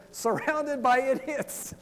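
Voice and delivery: loud, high, drawn-out comic answer; mock exasperated declaration. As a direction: proclaim it slowly for a laugh. Retold exasperated Facebook answer.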